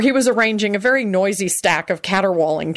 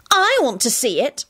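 defiant; 7 semitones higher than usual. Acted. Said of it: "Solange announced defiantly".

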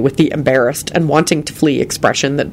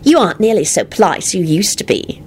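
slightly higher, cheerful tease; 4 semitones higher than usual. A narrator's reading. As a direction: tease him brightly. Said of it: "Rosie told Lupin cheerfully".

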